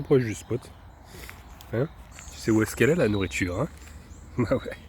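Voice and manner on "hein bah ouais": ordinary delivery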